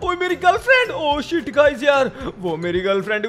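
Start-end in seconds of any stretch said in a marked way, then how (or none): 0.0-2.1 high-pitched panic, voice far up; panicked high cry. Acted. Upset boyfriend crying out his girlfriend is gone, high panic. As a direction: wail in alarm at losing her.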